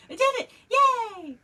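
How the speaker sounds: high, loud cheer. Real, not acted. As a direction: celebrate like you won. Celebrating success.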